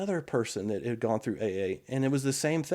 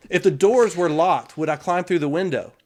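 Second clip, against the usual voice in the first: ordinary delivery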